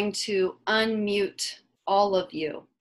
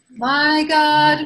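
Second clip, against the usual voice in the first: loud, higher sustained cry; 5 semitones higher, 9 dB louder than usual. Real, not acted. Leader starting the congregation's shared cry to God, loud call. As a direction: call it out loudly to lead the group. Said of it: "And so together we cry".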